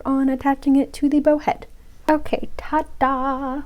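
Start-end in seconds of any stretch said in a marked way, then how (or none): 2.1-3.6 slow, higher-pitched presenting flourish; proud, drawn-out reveal; showy ta-da. Real, not acted. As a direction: draw it out like unveiling finished work. Presenting the finished piece.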